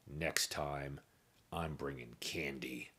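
hushed beady-eyed warning; quiet menace. A narrator's reading. His eyes got extra beady.

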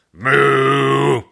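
loud mooing bellow; loud bovine moo; bull moo. A narrator's reading. Bull charging.

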